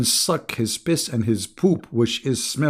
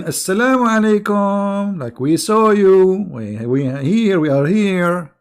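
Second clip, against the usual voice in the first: slow, loud and higher, a warning not a hello; pointed loud greeting; 6 semitones higher, 4 dB louder, slower than usual. Retold or imitated. Man catching the Prophet mid-act, slow loud and pointed. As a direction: drag it out, we caught you. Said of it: "and somebody saying".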